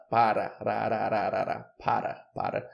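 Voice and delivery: tapped R in "para, rararararara", a quick flap of the tongue; Brazilian tapped R. Shown as a demonstration. Teacher demonstrating the tapped R in "para". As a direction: rattle "rararararara" with a light tongue tap. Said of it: "Qual que é o som do R brasileiro?".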